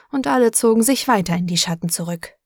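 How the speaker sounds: ordinary delivery, nothing marked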